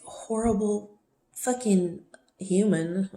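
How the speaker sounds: each word dragged out slowly for emphasis; slow emphatic rant. Real, not acted. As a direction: spell out your disgust word by word, slowly. Emphatic self-reproach about him.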